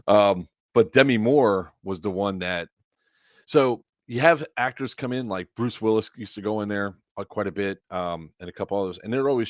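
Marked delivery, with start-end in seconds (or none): none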